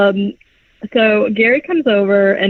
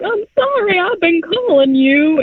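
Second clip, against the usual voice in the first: exaggerated imitation of an apologizing man; pleading apology; 10 semitones higher than usual. Phil apologizing to Julie, mock pleading. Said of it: "Phil is like".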